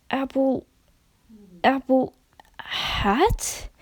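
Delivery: slow, unsure guess ending in a whisper; hesitant guess. A narrator's reading. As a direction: grope for the name, then whisper it. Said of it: "the mare guessed".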